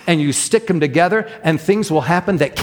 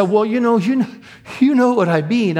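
flustered; 7 semitones higher than usual. Retold or imitated. Woman flustered in retold exchange.

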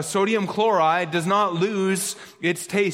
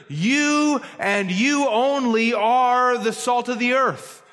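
"you" stretched each time, slow and higher; stress on YOU; 5 semitones higher, slower than usual. Shown as a demonstration. Preacher spelling out the verse's sense, heavy drawn-out stress on "you". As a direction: hammer "you" and "you only", drawing them out. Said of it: "the emphasis on".

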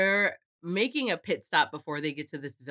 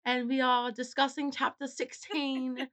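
higher-pitched mock British host; put-on British accent; 6 semitones higher than usual. Welcoming listeners to the podcast in a put-on British accent. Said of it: "I can't do that accent".

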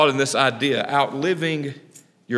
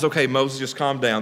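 softer, calming voice; soothing God; 5 semitones lower, 5 dB quieter than usual. Retold or imitated. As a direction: talk Moses down gently. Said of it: "This is the Matthew remix".

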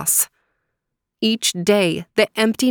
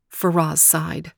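usual speaking voice

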